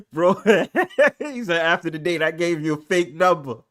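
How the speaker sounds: high, loud, laughing through the quote. Real, not acted. Host cracking up telling fake-number story. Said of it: "I cannot stop laughing".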